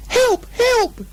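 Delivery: very high panicked squeal; a terrified little pig screaming for help; shrill, very high cries of panic; Piglet crying out. A narrator's reading. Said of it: "cried Piglet".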